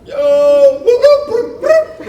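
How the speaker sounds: high, loud whoop whoop call across the street; hollering to get his friend's attention. Retold or imitated. A call across the street to an old school friend, high and loud.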